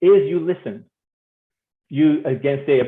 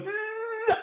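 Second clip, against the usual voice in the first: a thin, high buzzing hum, much softer; imitated buzz; 8 dB quieter than usual. Showing how the wife reacts, a soft high buzz. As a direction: hum a tiny high zzz. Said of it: "it goes".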